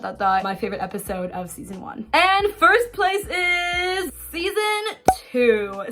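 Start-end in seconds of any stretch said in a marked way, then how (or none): 2.1-5.8 very slow, high announcer build-up; milk the number one reveal; hammed-up countdown climax; dramatic reveal.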